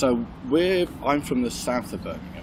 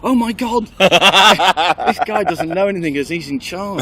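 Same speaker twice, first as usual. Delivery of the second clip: loud, laughing mock panic, dragged out; hammed-up alarm, laughing through it; 6 semitones higher, 8 dB louder, slower than usual. Hearing a Brummie expert on the radio, laughing mock panic. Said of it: "I'll panic slightly and go".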